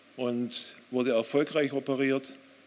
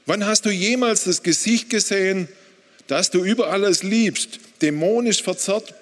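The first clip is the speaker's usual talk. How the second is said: loud, high, accusing outburst; furious reproach; 6 semitones higher, 5 dB louder than usual. Retold or imitated. Husband confronting God over his wife's seizures, furious reproach. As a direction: throw the question at God, raised and forceful. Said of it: "Ich antwortete laut und wütend".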